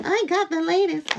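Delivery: high, smug show-off voice; mock proud Cybertruck owner; mock buyer voice. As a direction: brag like a proud new owner.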